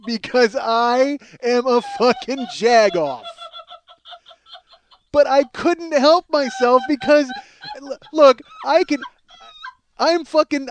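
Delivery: loud, high comic rant; hammed-up furious outburst. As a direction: confess it in worked-up exasperation. Comic rant about his own temper.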